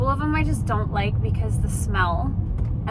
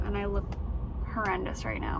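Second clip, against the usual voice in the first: normal talking voice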